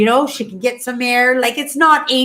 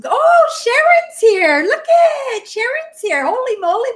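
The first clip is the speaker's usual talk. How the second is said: much higher, bright excited welcome; excited greeting; 13 semitones higher, 4 dB louder than usual. Real, not acted. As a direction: gush over a friend popping in. Greeting a viewer who just arrived.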